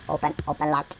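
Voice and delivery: low, soft, to yourself; low murmur. Real, not acted. Player fiddling with a lock, low soft murmur. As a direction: say it low and small.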